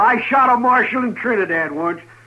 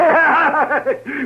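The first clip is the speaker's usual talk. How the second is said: a short, high, mocking laugh; scornful laugh; 7 semitones higher than usual. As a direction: laugh in his face. Outlaw laughs off death threat.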